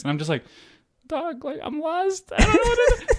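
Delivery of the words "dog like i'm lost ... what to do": very high squeaky plea, breaking into laughter; mock pleading. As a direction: play helpless and lost, comically high. Pleading with armed soldiers, played comically.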